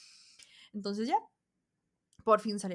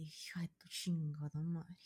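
soft, slow curse under the breath; muttered curse; 3 semitones lower, 11 dB quieter, slower than usual. Retold or imitated. Glaring at the woman behind her, a muttered curse. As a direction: swear it quietly through the teeth.

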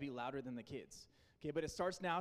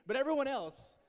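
raised call; 9 semitones higher, 8 dB louder than usual. Real, not acted. Challenging the volunteers to be louder.

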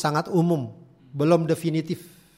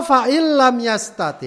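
slow, recited Arabic; hadith recitation; 5 semitones higher, 3 dB louder, slower than usual. Acted. Preacher quoting the Prophet, slow recitation. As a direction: recite the hadith line slowly. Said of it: "kata Rasulullah".

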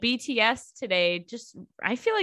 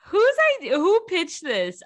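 incredulous; 10 semitones higher, 4 dB louder than usual. Real, not acted. Incredulous follow-up.

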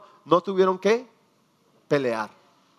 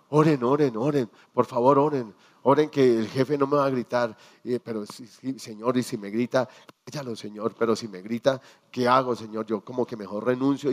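anxious prayer; 8 semitones lower, 3 dB quieter than usual. Retold or imitated. Acting a fearful worker's prayer.

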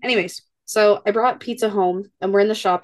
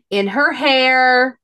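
slow, louder, higher disgusted punchline; horrified emphasis; 4 semitones higher, 5 dB louder, much slower than usual. Real, not acted. Telling cohost about daycare mess, slow horrified emphasis. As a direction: land it like the grossest detail. Describing daughter's diaper disaster.

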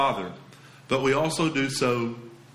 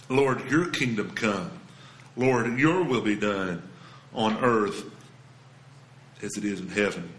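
reverent prayer; 7 semitones lower than usual. Acted. Pastor modelling Jesus' prayer to believers, hushed and reverent.

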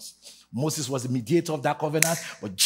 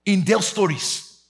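sung preacher cadence, loud; preaching; 7 semitones higher, 8 dB louder than usual. Real, not acted. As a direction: chant it like a fired-up preacher. Preacher chanting sermon at full force.

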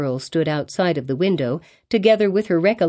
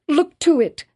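wild appeal; 9 semitones higher than usual. A narrator's reading. Hester begging the pastor, high desperate cry. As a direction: beg as if near madness. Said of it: "this wild and singular appeal".

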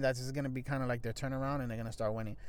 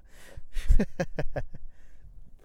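burst of laughter; 3 semitones higher, 7 dB louder than usual. Real, not acted. Laughing after cursing out fans.

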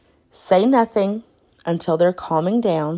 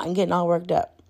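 normal talking voice

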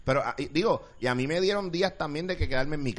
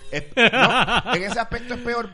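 higher and louder, laughing through it; 8 semitones higher, 8 dB louder, slower than usual. Real, not acted. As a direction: laugh while conceding. Laughing concession.

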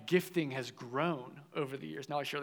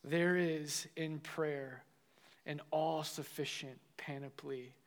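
slow, measured recitation with weighty pauses; solemn quotation reading; 3 dB quieter, slower than usual. A narrator's reading. Preacher reading Chrysostom on prayer to the congregation, solemn recitation. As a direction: read it slowly, phrase by phrase.

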